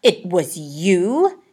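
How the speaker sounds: slow, loud accusing question; hissed. A narrator's reading. Horatio confronting Harvey, slow accusing hiss. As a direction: drag it out in stunned suspicion. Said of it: "he hissed".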